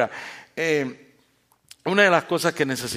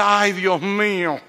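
slow, drawn-out, weary exclamation; exasperated sigh; 3 semitones higher, 3 dB louder, much slower than usual. Retold or imitated. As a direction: let it drag like your kid just said something silly. Mocks a parent annoyed by a child.

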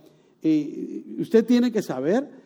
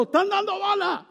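very high frantic cry, shrill with alarm; mother-in-law's very high panicked cry; mother-in-law yelling. Retold or imitated. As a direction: scream the warning from inside the house. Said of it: "mi suegra gritando".

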